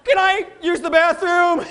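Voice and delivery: high, loud startled panic; hammed-up alarm, looking for an escape; mock panic. Audience member realising his five people are bad, loud mock panic. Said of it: "some people going".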